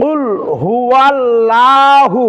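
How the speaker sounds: long drawn হুয়াল্লাহু with a light, thin 'laa'; thin lam. Shown as a demonstration. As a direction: recite হুয়াল্লাহু slowly, lam kept thin. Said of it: "আমরা এইভাবে অনেক সময় পড়ে থাকি". (হুয়াল্লাহু-র লাম পাতলা করে, টেনে)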